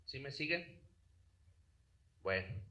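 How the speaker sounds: ordinary talk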